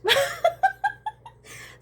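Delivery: bursts into a loud, high laugh; a shrieking laugh of disbelief. Real, not acted. Laughing during rant.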